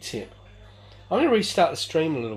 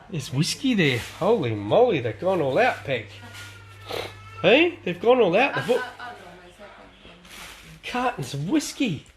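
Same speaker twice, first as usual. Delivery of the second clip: excited amazement; 7 semitones higher, 4 dB louder than usual. Real, not acted. Sees cartons of whiskey, amazed.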